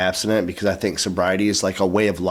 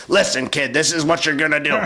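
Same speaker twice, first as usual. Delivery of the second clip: lightly voiced raspy tough old-timer, pitched up; raspy old-school dude; 7 semitones higher than usual. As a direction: lay down the law with a hint of rasp. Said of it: "really raspy voice".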